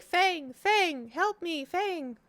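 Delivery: high-pitched plea for Fang; high little bird calling for help; high squeaky bird cries. Retold or imitated. X the bird voiced crying for help.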